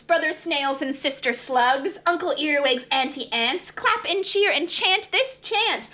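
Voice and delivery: ordinary delivery, nothing marked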